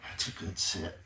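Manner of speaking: whispered, soft praise. Real, not acted. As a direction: breathe the praise to the pup.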